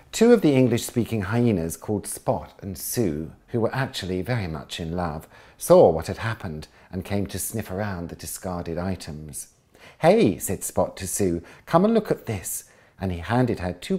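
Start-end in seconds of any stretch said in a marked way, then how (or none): 0.1-9.5 bright, lively storybook read; lively read-aloud of a children's book; story narration. A narrator's reading. 10.0-10.4 high, loud call of "hey"; an eager hyena calling to his mate; excited call. Acted. Spot calls out to Sue. 11.7-12.6 high, loud call of "hey"; an eager hyena calling to his mate; excited call. Acted. Spot calls Sue over.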